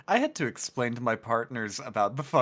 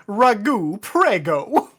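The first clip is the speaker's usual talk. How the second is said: mock Italian accent; 8 semitones higher, 8 dB louder, much slower than usual. Imagined Italian gag.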